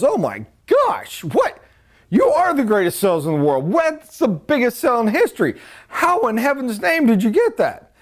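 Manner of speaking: a manager who can't believe the sales figure; amazed manager. Acted.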